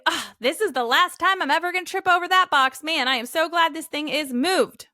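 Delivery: high-pitched, demonstrative relief and excitement; narrated excitement. Shown as a demonstration. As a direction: announce your win to the family with excitement. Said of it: "we need to narrate our excitement".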